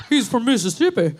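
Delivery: exaggerated Mississippi twang; Mississippi accent. Comic explaining Pop Pop's origins in his own thick Mississippi accent. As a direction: stay in the thick Southern voice. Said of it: "it's a southern accent".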